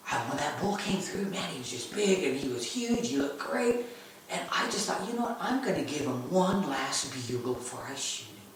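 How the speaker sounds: soft, understated explanation; very calm. Retold or imitated. Son explaining to dad why he waited, very calm. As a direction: explain it quietly, completely unfazed. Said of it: "and very calmly".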